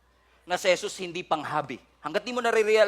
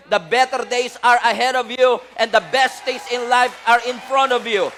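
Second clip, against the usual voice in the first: louder, higher encouraging exhortation; rousing preaching; 6 semitones higher, 8 dB louder than usual. Acted. Preacher urging the hurting to hold on, rousing. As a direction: lift them up with a rising call.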